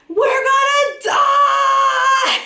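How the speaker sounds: very high, long drawn-out wail; hollering in panic. Retold or imitated. Passenger on the wild ride home, hollering in panic. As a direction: stretch it into a slow, loud mock-terrified holler. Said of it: "I'm literally hollering this".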